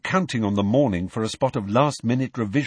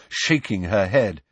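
usual speaking voice

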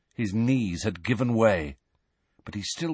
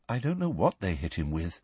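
sad; 4 semitones lower, 7 dB quieter than usual. A narrator's reading. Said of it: "said Luna sadly".

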